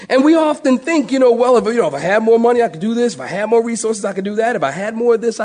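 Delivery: high, loud, climbing to a shout; rising preaching. Acted. Preacher to congregation, shouting the verse. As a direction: drive it home with force.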